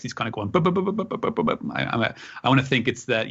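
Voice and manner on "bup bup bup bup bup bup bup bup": high, rapid burbling putter; Jetsons-style hovering motor noise; motor sound effect. Retold or imitated. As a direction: imitate a little cartoon motor puttering. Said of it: "we see his little sound effects".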